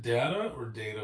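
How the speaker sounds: "data" first with a flat a, then with "day"; two pronunciations of data. Shown as a demonstration. Asking which way the friend says "data", both pronunciations contrasted. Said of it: "I say data".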